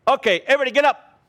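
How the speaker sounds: high, fast, loud call to rise; rally the room to its feet, fast and high; cheerful mock summons; rousing call. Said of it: "That kind of thing".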